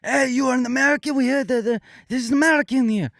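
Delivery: put-on high French teenage accent. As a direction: play the cocky French boys, French accent. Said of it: "hunky alpha French teenagers came up to me".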